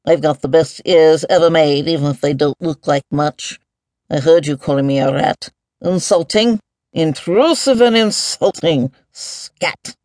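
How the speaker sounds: grumble, then rise on the insults; mildly huffy creature voice; annoyed boom. A narrator's reading. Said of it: "boomed the voice in annoyance".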